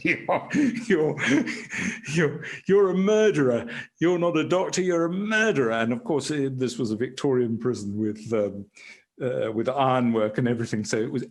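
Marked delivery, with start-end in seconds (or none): 0.0-5.7 retold scream. Doctor retelling a prisoner screaming at him, mild mock yell. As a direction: half-shout the accusation, imitating him. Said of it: "And he started screaming".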